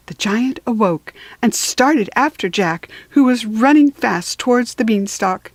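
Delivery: usual speaking voice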